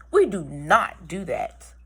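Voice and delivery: ordinary delivery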